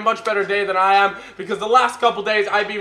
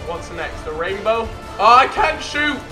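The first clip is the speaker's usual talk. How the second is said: normal talking voice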